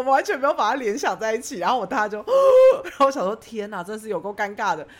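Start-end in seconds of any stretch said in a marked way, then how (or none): 2.2-2.8 loud, high gasp of realisation; sharp shocked gasp. Retold or imitated. Recalling the moment she realised who the guests were, a loud gasp. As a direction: suck in air in horror.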